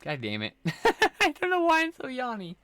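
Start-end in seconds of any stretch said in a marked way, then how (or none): 0.6-2.6 high-pitched giggly exasperation; laughing. Real, not acted. Streamer amused by own yawning, laughing.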